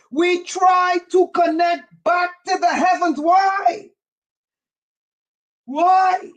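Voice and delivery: fervent preacher pressing the congregation; preaching climax. Acted. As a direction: drive the point home. Preaching at full force.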